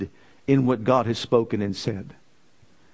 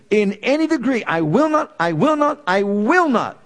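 loud, much higher I will not; rising preached vow; 6 semitones higher, 3 dB louder than usual. Acted. Preacher building God's vow to the crowd.

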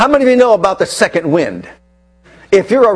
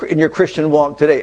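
normal talking voice

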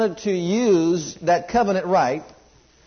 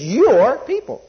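"your people" with "your" punched, louder; stress on your; 6 semitones higher, 5 dB louder, slower than usual. Acted. Moses pleading with God, "your" emphasized boldly. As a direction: hit "your" hard in "your people". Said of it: "again he says".

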